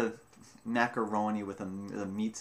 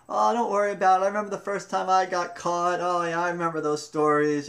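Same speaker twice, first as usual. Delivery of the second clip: higher, louder, friendly mock reassurance; consoling fellow prisoners; 8 semitones higher, 7 dB louder than usual. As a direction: imitate the cheerful inmates comforting the girl. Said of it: "They were like".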